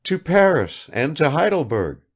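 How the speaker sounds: high, rapid-fire chatter of a vain lady; affected negligence. A narrator's reading. Kukshina showers Bazarov with questions, high and affected. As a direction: pour out questions without waiting.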